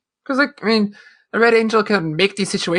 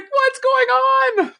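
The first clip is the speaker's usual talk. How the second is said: much higher, slow, laughing through the words; laughing bafflement; much slower than usual. Retold or imitated. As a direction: squeal the question out through laughter. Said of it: "I was like".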